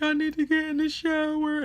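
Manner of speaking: high, loud mock-crying voice; tearful plea. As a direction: act out pleading through tears. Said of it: "And I'm crying".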